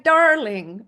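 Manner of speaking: put-on one word in a lightly tried Irish accent; a playful, half-hearted go at an Irish lilt; Irish accent attempt. To the Irish friend on voice messages, a mock Irish accent. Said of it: "That's about all I can do".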